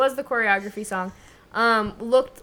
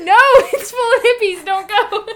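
laughing; 10 semitones higher, 9 dB louder than usual. Real, not acted. Joking warning while laughing.

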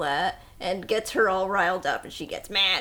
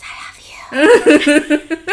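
whisper; 5 semitones higher, 13 dB louder, much faster than usual. Retold or imitated. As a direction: whisper the hidden love line. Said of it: "what he was really saying".

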